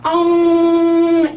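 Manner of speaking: 엉 sung long, high and loud, strongly nasal; drawn-out nasal 엉; nasal eng. Shown as a demonstration. Teacher modelling a strongly nasal 엉. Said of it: "비음이 확실하게 나죠".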